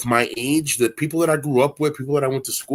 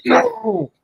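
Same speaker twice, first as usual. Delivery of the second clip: high squeak. Retold or imitated. Acted pained reply.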